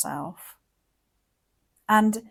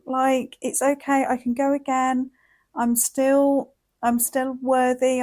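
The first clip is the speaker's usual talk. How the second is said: higher, bright pep talk to yourself after a mistake; self-encouragement; 4 semitones higher, 4 dB louder than usual. Retold or imitated. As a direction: lift yourself up like cheering on a child. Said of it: "you're like".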